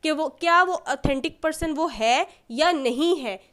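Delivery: ordinary delivery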